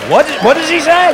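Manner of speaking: high, loud, bewildered question; baffled. Acted. Viewer back home failing to understand the engineer, baffled. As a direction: ask in high-pitched confusion.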